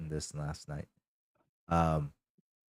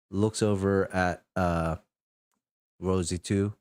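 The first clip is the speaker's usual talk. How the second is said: ordinary talk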